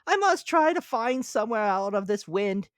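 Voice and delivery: high, loud cartoon grasshopper; bright piping insect voice. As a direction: squeak it out as the carefree grasshopper. Grasshopper character voice.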